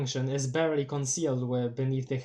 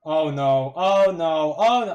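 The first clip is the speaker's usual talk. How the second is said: escalating alarm; 9 dB louder, slower than usual. Real, not acted. Streamer reacts to the game's attack, increasingly alarmed.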